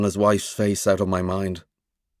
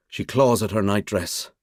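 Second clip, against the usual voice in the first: plain reading voice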